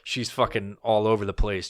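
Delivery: ordinary talk, nothing marked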